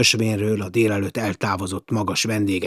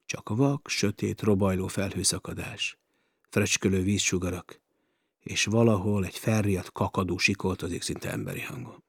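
ordinary delivery, nothing marked

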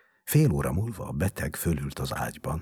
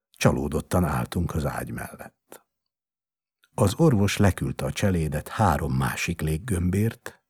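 plain reading voice

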